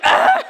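high, loud mock groan; comic exhausted ugh; exasperated groan. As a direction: groan it out together. Both deflated groan.

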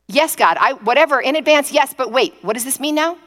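agree, then backpedal in a fluster; flustered bargaining. Acted. Answering God yes but wanting details, flustered.

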